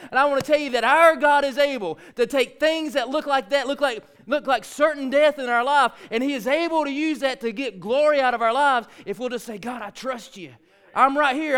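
loud, high-pitched fervent sermon; full-force preaching. Real, not acted. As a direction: preach it hard, driving every phrase.

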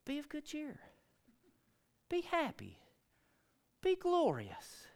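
high, soft, slow sing-song comfort; mock a hollow cheerleader's advice; mocking cheerful platitude. Someone's hollow comfort in a storm.

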